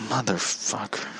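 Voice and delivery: very soft inner-thought swearing; muttered curse. Retold or imitated. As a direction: curse quietly to yourself. Cursing in his head on the slide.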